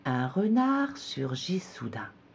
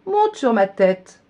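slow, higher coaxing; sly fox; 8 semitones higher, 7 dB louder than usual. A narrator's reading. Fox luring the gingerbread man in the river, slow and higher. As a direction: lure him gently onto your head.

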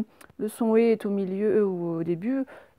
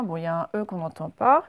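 ordinary talk

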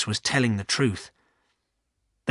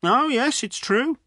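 higher-pitched insistent villager voice; 8 semitones higher than usual. A narrator's reading. Villager arguing that ghosts have been seen, insistent. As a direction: insist on it to the sceptics.

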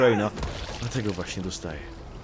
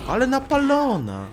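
mock squeal; 6 dB louder than usual. Mocking eager enemy.

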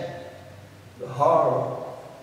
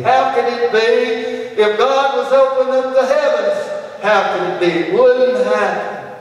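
incredulous protest; 8 semitones higher, 4 dB louder than usual. Acted. Preacher acting the doubting officer protesting, loud. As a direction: cry out that it can't be done.

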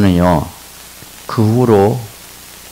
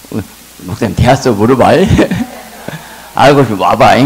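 much higher and louder, laughing preacher's cry; full-force preaching; 3 semitones higher, 6 dB louder than usual. Acted. Pastor answering elders' mockery, preaching loud and high. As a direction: preach it out loud over the crowd.

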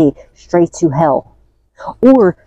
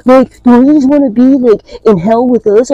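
high, loud jeering outrage; mock the dark ones, loud and high; mocking angry demons; 7 semitones higher, 4 dB louder than usual. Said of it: "They're like".

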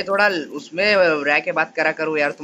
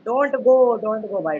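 raised plea "Don't go"; pleading don't go; much slower than usual. Real, not acted. Begging teammate not to go.

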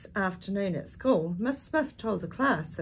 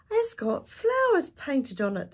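put-on high, louder children's cry; children shouting; 8 semitones higher, 5 dB louder than usual. A narrator's reading. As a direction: call it out like excited kids. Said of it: "they shouted".

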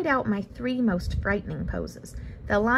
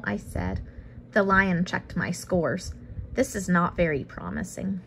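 usual speaking voice

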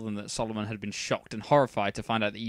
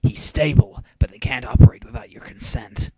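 hushed Seto voice; 9 dB louder than usual. A narrator's reading.

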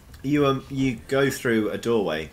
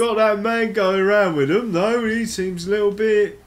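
high, loud chattering fungus; gossiping mushroom voice; 13 semitones higher, 7 dB louder than usual. Acted. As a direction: gossip about them in a high voice. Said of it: "the ones from further back being like".

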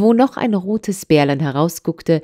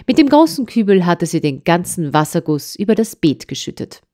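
plain reading voice

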